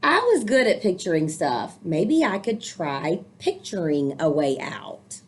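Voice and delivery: put-on lively read-aloud for children; storybook reading. A narrator's reading. Teacher reading a picture book to young listeners, lively storytelling. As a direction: read the book warmly to the kids.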